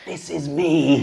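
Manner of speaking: slow, high, hammed-up plea; mock wail. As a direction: draw it out as a pretend wounded whine. Acted defensive reply to girlfriend.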